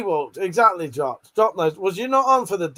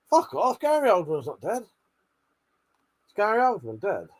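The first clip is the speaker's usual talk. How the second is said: ordinary talk, nothing marked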